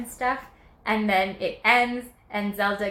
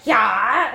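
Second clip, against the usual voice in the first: loud yell that swoops up at the end; Link's battle cry; 11 dB louder than usual. Acted. Storyteller voicing Link fighting Ganondorf, loud rising battle yell. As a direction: throw out a long rising attack shout. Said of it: "And he's like".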